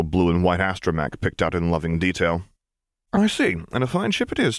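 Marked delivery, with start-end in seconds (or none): 3.1-4.6 high-pitched praise for a little one; admiring to a child. A narrator's reading. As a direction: admire the toy, pitched well up for a small child. Man admires a child's toy.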